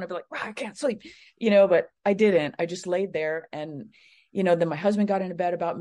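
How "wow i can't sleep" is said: higher, mock-frustrated outburst, kept soft; pissed off. Imagining a sleepless night, mildly mock pissed off. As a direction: grumble it in mild mock anger. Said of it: "I'd get all pissed".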